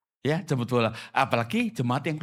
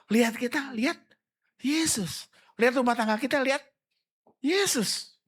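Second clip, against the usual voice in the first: high, fervent preaching call; lifted, impassioned sermon climax; rising climax; 10 semitones higher than usual. Real, not acted. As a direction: build to a ringing peak.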